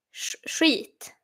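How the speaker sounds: "skit" as drawn-out "shreet", hushed sh first; Swedish pronunciation. Shown as a demonstration. Streamer demonstrating slowly for viewers, Swedish pronunciation. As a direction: isolate the sh, then stretch "skit" with long ee. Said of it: "shit in Swedish".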